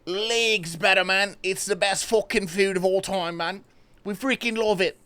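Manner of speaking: mock Aussie voice, loud and excited; Australian accent gushing about KFC; imitating an Australian. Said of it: "they're like".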